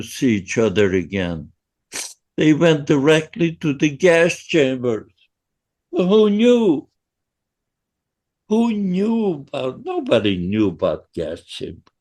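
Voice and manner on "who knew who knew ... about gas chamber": shaking as the memory comes back; shaky voice. Real, not acted. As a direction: voice trembling with emotion. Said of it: "I'm telling the story and I'm, I'm shaking".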